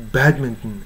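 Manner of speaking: "badminton" as bad-m'n-n; American reduction. Shown as a demonstration. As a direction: swallow the end of "badminton". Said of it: "バドミントンとは言いませんね".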